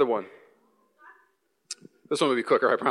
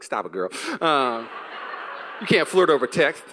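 low, laughing mock scold; playful scolding; 3 semitones lower than usual. Husband laughing off his wife's flirty text, low mock scold. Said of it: "I was like".

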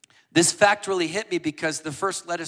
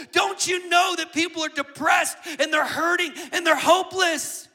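higher and louder, pleading with a tearful edge; anguished retold shout; 10 semitones higher, 8 dB louder than usual. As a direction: hurl the officer's desperate plea at them. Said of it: "he starts weeping and he says".

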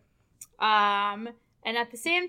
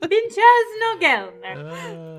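dramatic; 4 semitones higher, 8 dB louder, much slower than usual. Pretend. Savoring funny villain name.

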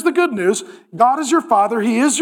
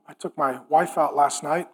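normal talking voice

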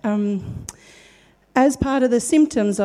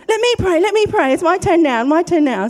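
put-on high, loud childlike pleading for a turn; eager begging; 6 semitones higher, 5 dB louder than usual. Friend eagerly begging to pray next, high and loud. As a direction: beg for your turn like an excited kid.

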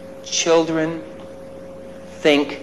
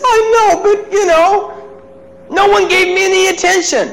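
put-on crying child. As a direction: play the hurt boy pleading. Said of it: "And he says".

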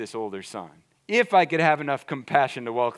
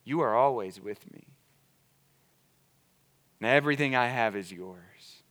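tender reassurance; 6 semitones lower, 4 dB quieter than usual. Acted. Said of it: "Jesus pursues our hearts and goes".

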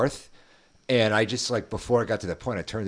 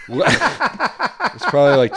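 laughing; 6 semitones higher, 6 dB louder, much slower than usual. Real, not acted. Laughing while joking.

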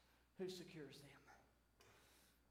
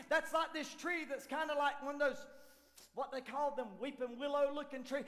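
ordinary delivery

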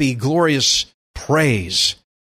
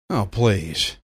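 low, soft dismissive scoff; 8 semitones lower, 5 dB quieter than usual. Real, not acted. Preacher brushing off a worried churchgoer's excuse, scoffing.

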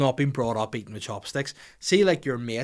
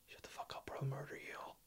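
put-on threatening hushed whisper to the kids; menacing whisper; 2 semitones higher, 19 dB quieter, faster than usual. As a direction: hiss the threat like a horror villain. Menacing kids under the fence.

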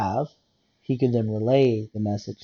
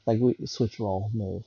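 usual speaking voice

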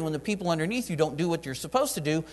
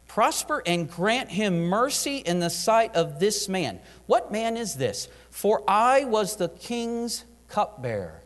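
slow, drawn-out verse reading, higher; scripture reading; 5 semitones higher, slower than usual. Acted. Preacher reading Nehemiah's plea to the church, slow and solemn. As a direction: savour each word of the verse.

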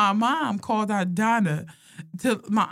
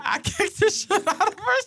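higher voice breaking into laughter; laughing retelling; 11 semitones higher than usual. Retold or imitated. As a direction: quote mom while cracking up. Retelling mom's story, cracking up.

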